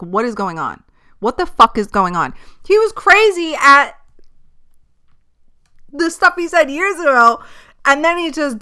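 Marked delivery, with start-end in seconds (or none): 2.7-8.6 much higher, louder outburst; incredulous rant. Real, not acted. Ranting to viewers about [name]'s words, high and loud. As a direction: let the disbelief burst out high.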